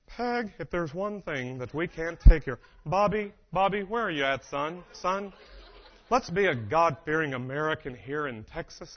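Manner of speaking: slow Texan cartoon-dad voice; Hank Hill. Cartoon dad lecturing wife and son, slow Texan drawl. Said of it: "That is Hank Hill".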